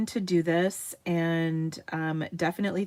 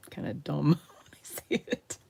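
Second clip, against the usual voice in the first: laughing; 3 semitones lower than usual. Real, not acted. Embarrassed laugh mid-line.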